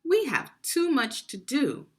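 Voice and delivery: loud, high, impatient middle brother; busy middle brother. Acted. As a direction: brush him off, too busy to help. Middle brother brushes off chores.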